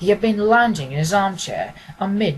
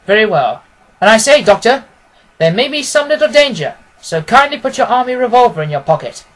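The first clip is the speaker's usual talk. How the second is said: louder, brisk and commanding detective; a keen detective, louder and decisive; Holmes voice; 3 semitones higher, 8 dB louder than usual. Acted. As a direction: speak as the sharp, confident Holmes instructing his friend. Holmes speaking in the story.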